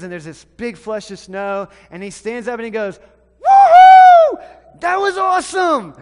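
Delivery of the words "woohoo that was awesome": high, loud, thrilled whoop; cheering whoop. Retold or imitated. Sam after landing the jump, cheering loudly. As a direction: shout it out like a kid flying off a ramp.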